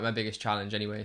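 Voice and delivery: ordinary delivery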